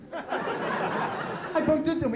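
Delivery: slow, exaggerated New York drawl on "I bumped into him"; heavy New York accent, hammed-up. Shown as a demonstration. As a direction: stretch "I bumped into him" like a thick New Yorker. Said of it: "I've started to use my upper lip".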